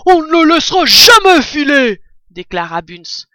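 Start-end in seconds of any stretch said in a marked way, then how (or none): 0.1-2.0 put-on voix de fermier, plus aiguë et très forte, accent sur jamais; renchérit en hurlant; cri déterminé. Bunz renchérit avec colère. (Bunz hurle son serment)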